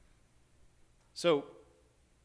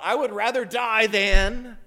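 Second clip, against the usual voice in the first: fiery outburst; 6 semitones higher, 10 dB louder than usual. Retold or imitated. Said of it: "as if he stops his thought".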